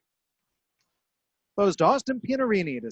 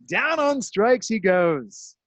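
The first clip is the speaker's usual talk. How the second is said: drawn-out strikeout call; 5 semitones higher, 4 dB louder, much slower than usual. Real, not acted.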